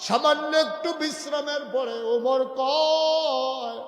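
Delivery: high, loud, slow chanting shout; full-force preaching. Acted. Preacher telling Umar's story to congregation, full-force cry. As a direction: belt it out and stretch the ending.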